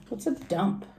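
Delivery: ordinary delivery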